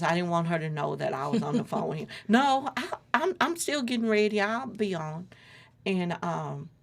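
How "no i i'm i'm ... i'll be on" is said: high, louder call, brushing her off. Retold or imitated. Waving off her sister's offer to wait, called out loud. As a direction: call out brightly so she won't suspect.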